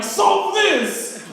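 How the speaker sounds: high, loud mock-menacing yell; shouted scare. Uncle scaring kids with math at the window, shouted threat. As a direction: yell it as a sudden ambush.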